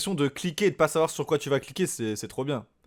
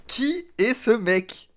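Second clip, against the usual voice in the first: astonished repeat; 7 semitones higher, much slower than usual. Real, not acted. Streamer stunned by a Russian verse, asking who this guy is in disbelief. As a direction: ask it again as if you can't believe it.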